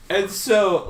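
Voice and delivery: usual speaking voice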